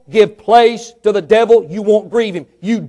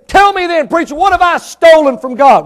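higher, louder mock challenge; challenging churchgoer; 7 semitones higher, 5 dB louder than usual. Churchgoer asking the preacher what he stole, higher. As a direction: raise the pitch, indignant.

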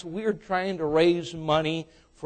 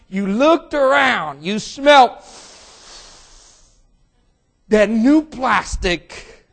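preaching at full force; 8 semitones higher, 11 dB louder than usual. Acted. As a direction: preach it with rising fervour. Preaching builds on the new car.

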